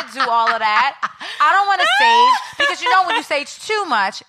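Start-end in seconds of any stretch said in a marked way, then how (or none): 1.4-4.2 high squealing laugh through the words; loud laughing squeal; laughing shout. Real, not acted. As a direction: burst out laughing as you say it.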